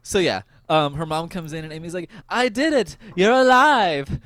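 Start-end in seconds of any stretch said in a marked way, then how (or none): 2.3-4.2 high, drawn-out joyful exclamation; loud, elated acted outburst; mock triumphant cry. Retelling Amy's triumph at mom alive.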